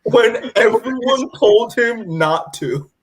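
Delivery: slow, high, incredulous; exasperated. Real, not acted. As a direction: drag it out in disbelief. Exasperated aside.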